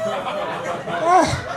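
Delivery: high-pitched dawning wonder; gushing girlfriend. Retold or imitated. Comic voicing his girlfriend finally understanding him, gushing. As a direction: coo it high, mock-profound.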